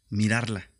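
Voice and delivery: ordinary talk, nothing marked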